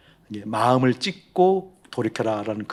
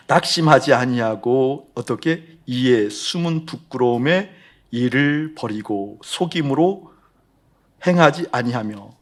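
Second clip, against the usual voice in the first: slow, louder, deliberate reading; scripture reading; 6 dB louder, slower than usual. Acted. Pastor reads the scripture to the congregation, slow and solemn. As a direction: read the verse out with weight.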